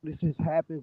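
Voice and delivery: ordinary delivery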